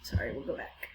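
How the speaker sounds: soft unvoiced whisper; hushed whisper off-mic. Real, not acted. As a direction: whisper it as an aside. Whispered aside before reading.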